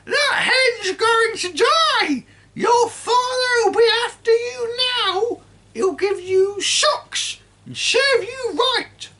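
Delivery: put-on high, loud screeching old woman; cranky grandma yelling from the roof; shouting grandma. A narrator's reading. Grandma voiced throughout.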